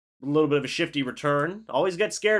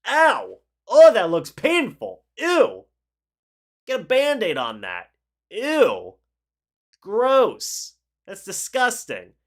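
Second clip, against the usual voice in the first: grossed-out wince; 5 semitones higher, 6 dB louder, slower than usual. Real, not acted. Wincing at Burrow's pinky injury.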